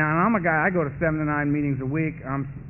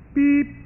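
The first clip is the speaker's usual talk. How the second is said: mock answering machine beep; 9 semitones higher, 5 dB louder than usual.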